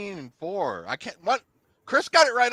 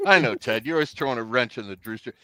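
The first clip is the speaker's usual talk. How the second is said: normal talking voice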